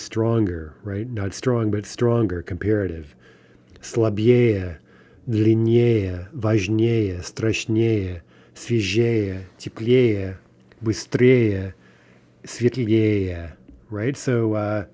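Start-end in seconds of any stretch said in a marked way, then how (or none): 3.8-13.5 each word from "Слабее" to "светлее" stressed on its next-to-last vowel, the first е of -ее; stress on the ending. Shown as a demonstration. Teacher lists Russian comparatives for learners, stressing the endings of "Слабее", "теплее", "светлее". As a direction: lean on the -е́е ending of "длиннее", "важнее", "быстрее". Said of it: "again, note the stress there".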